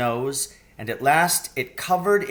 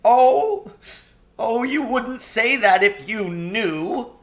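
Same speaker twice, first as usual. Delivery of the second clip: between sobs; 9 semitones higher, 5 dB louder, slower than usual. A narrator's reading. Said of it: "between his sobs".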